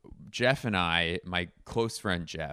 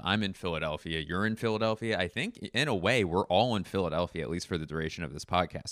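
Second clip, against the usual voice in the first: ordinary delivery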